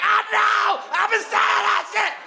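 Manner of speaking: very high mock shout; excited shout. Comedian losing his mind at a fan, shrieking high. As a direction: yell it like he lost his mind. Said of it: "And he'll be like".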